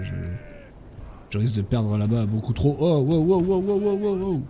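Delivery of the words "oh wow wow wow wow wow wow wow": rising, higher amazed wow wow. Real, not acted. Player marvelling at his army, amazed wows. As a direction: marvel at what the troops just did.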